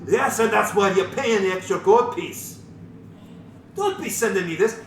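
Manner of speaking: a gruff tavern keeper snapping at customers; loud, indignant innkeeper; gruff barkeep. Acted. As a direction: barkeep Durnan griping about payment. DM voices tavern keeper Durnan.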